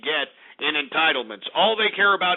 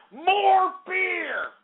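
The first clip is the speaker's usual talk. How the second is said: yelling for beer; 13 semitones higher than usual. Acted. Host yelling for more beer.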